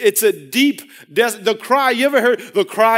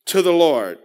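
ordinary talk, nothing marked

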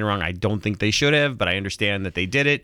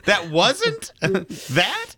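very slow and high, "that" rising in question; emphasized THAT; 9 semitones higher, much slower than usual. Shown as a demonstration. Host experimenting with emphasis, drawn-out rising "that". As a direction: stretch "that" upward, questioning.

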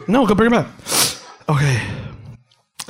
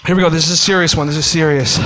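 usual speaking voice

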